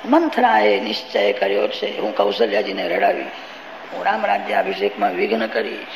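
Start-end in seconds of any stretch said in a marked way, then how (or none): 2.0-5.9 voz bem mais grave e baixa de Manthara tramando; scheming Manthara. Acted. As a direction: murmure o plano como uma criada rancorosa. Manthara's scheming resolve voiced.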